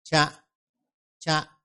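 cha said with a puff of air after the ch; aspirated cha drill. Shown as a demonstration. Teacher models aspirated cha for students. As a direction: breathe out hard after the ch of cha. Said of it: "Thứ hai là cha".

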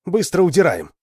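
high, loud urging to flee; urgent cry. A narrator's reading. Lita urges Alan to run, crying out. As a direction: cry out to get moving. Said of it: "закричала Лита".

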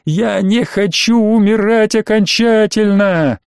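high, very slow terrified wail; hysterical scream. Acted. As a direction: scream it in sheer panic. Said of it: "истерично закричала".

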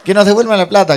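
high, loud heckler's yell; retold shout. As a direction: demand a refund, hammed up. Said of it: "gritó otro tipo". (grito de protesta imitado, agudo)

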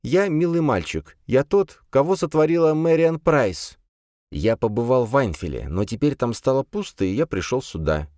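put-on high lisping wild boy. Voices the boy Clade.

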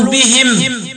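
"بهم" as bihim; i after the h of "بهم", stop on m; kasr ha, still mim. Shown as a demonstration. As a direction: end "بهم" with -him. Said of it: "وقرأوا بإسكان الميم وكسر الهاء وقفا".

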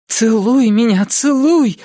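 high, hammed-up breathless plea; gasping. A narrator's reading. Narrator parodying pulp romance demand for kisses, gasping. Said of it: "задохнулась Шайна".

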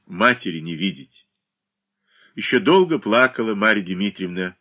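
ordinary delivery

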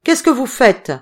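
loud, high demand through the shutter; yelled question. A narrator's reading. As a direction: yell it out at them. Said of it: "hurla-t-il". (question hurlée)

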